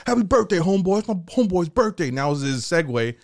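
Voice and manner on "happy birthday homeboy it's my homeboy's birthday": inmate bully. Retold or imitated.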